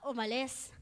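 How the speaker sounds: ordinary talk, nothing marked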